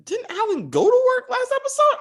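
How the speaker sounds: high, incredulous question; confused disbelief. Retold or imitated. Recapping, he asks the screen in high confused disbelief. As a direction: pitch way up in baffled disbelief. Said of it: "I was like".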